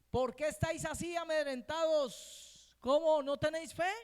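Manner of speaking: stern rebuke. Retold or imitated. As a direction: rebuke them for their fear. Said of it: "Le llama la atención".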